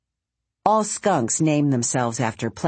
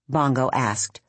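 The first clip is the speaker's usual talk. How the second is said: plain reading voice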